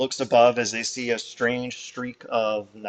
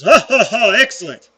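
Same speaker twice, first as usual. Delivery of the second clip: hearty booming ha ha ha then a louder showman voice; louder jolly showman greeting; jovial boss; 4 semitones higher, 6 dB louder than usual. Acted. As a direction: play the cheerful boss hamming it up. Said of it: "He looks at the lot of you and goes".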